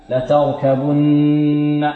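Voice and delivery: long nasal hum held on the doubled n of لتركبن; غنة النون المشددة. Shown as a demonstration. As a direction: chant لتركبن slowly and hold the "nn". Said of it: "عندنا النون هنا مشددة". (إطالة الغنة في نون لتركبن)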